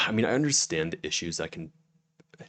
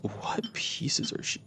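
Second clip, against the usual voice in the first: whisper; 6 dB quieter, much slower than usual. Real, not acted. Reading a chat question aloud.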